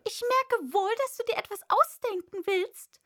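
high, loud bird voice; sharp pigeon interrogation; challenging pigeon. A narrator's reading. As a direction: demand an answer as an agitated pigeon. Pigeon accuses Alice of inventing.

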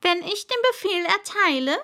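high, loud royal order; pompous king's decree; commanding king. Acted. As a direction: forbid it with full authority. King speaking.

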